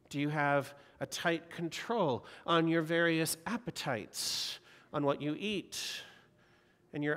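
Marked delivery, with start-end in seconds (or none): none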